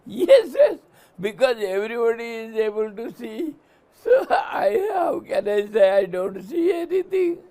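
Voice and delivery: laughing at blind faith; laughing mock believer. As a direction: mimic the flatterer, cracking up.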